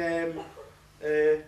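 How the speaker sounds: ordinary talk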